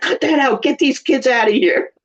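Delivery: high, mock-annoyed shooing; hammed-up bossy disciples chasing kids off; scolding disciples. Disciples shoo toddlers away from Jesus, mock scolding. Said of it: "his disciples being like".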